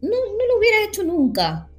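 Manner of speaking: usual speaking voice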